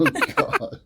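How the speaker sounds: high, laughing oh God; laughing groan. Real, not acted. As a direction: groan through a laugh at the joke. Reacting to Christy's joke.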